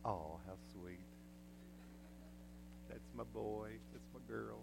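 soft, limp little "how sweet"; mock polite murmur. Mocking the timid parent in the stands, soft and limp. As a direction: lifeless praise, barely said. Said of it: "do you just go".

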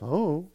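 "Oh" hummed as a wordless I-don't-know; mumbled teen shrug. Retold or imitated. Kid asked about the room, "Oh" as a mumbled hum. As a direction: softly slur "Oh" into an evasive mumble. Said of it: "It sounds like this".

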